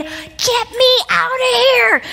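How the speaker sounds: high, slow, strained plea to escape; panicked plea. Retold or imitated. Nervous speaker before going on, pleading high and slow. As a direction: beg to get off the stage.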